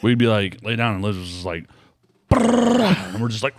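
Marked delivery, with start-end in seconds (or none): 2.3-3.1 playful growl. Retold or imitated. Kid imitating dog's growl.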